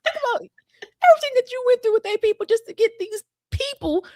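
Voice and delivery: high, earnest plea; pleading Moses. Retold or imitated. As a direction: remind God of his promises urgently.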